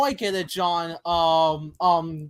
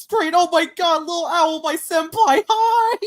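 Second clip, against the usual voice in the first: higher and louder, gushing over a crush; excited fangirling; 8 semitones higher, 8 dB louder than usual. Acted. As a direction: squeal a greeting to your senpai. Excited fangirling over Little Owl.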